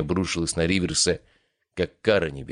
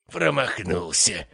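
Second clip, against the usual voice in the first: mad laughter; slower than usual. Acted. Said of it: "безумно захохотал он".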